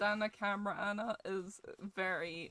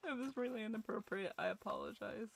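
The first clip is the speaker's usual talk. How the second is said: usual speaking voice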